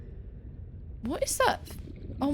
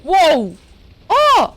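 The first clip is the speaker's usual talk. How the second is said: loud sudden yelp of surprise; startled shout; 7 semitones higher, 17 dB louder than usual. Real, not acted. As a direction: jump and yell in shock. Startled in game.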